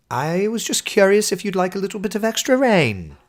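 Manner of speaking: high, plummy mock-British accent; polite British gent. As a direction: put on an over-polite English gentleman's accent. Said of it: "Nigel is going to be a polite gent of a storm".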